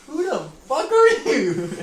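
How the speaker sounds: high, loud, drawn-out demand; incredulous yell. Retold or imitated. Boy confronts strange girl in his house, incredulous yell. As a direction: yell it slowly in mock outrage.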